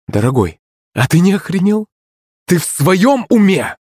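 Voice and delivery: high-pitched angry tirade; scolding, incredulous outburst; outraged wife. A narrator's reading. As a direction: lash out at him in rising indignation. Furious wife lashes out at husband.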